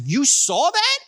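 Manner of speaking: shocked disbelief; stunned "you saw that"; surprised. Retold or imitated. Startled reply on phone.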